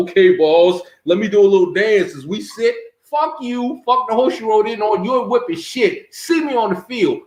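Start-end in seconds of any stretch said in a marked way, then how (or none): 0.1-2.8 sarcastic, punched-up yes-boss act; loud mocking "okay, boss"; mock subservient. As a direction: play at obeying, with open scorn. Mocking imagined submissive reply to trash talk.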